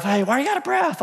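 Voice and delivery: high-pitched questioning wife on the phone; imitated wife.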